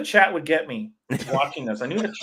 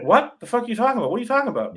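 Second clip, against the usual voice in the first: exasperated; 9 semitones higher, much faster than usual. Retold or imitated. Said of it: "And everyone's like".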